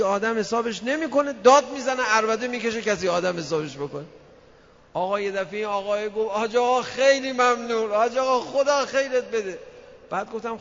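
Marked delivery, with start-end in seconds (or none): 1.3-4.0 louder and higher, acting out shouting; mock shout. Cleric acting out how the husband shouts, louder. 6.3-9.6 grateful husband. Retold or imitated. Husband thanking the cleric, loud and high.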